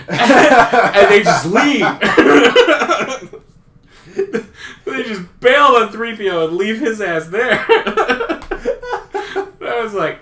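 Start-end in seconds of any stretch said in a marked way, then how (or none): 1.9-3.4 big high loud laughing fit. Real, not acted. Telling his friend the story, loud real laughter. 7.7-9.6 very high cackling laugh. Real, not acted. Laughing at Han abandoning C3PO, high cackle.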